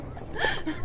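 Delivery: a high, falling burst of laughter; high giggle. Acted. Girl running along the walkway, high giggling laugh. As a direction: giggle as she runs away.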